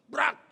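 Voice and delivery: loud, high crash noise; imitated crash. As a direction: blurt a sudden "brak" like an impact. (tiruan bunyi tabrakan)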